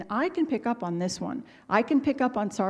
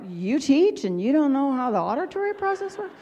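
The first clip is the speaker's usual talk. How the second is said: higher-pitched, baffled student disbelief; mock incredulous questioning, pitched up; incredulous kid; 4 semitones higher than usual. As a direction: imitate a pupil astonished at the teacher. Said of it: "they're just looking at you like".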